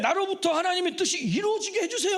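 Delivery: prayer building to loud, high pleading; fervent prayer. Retold or imitated. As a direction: plead harder with each line.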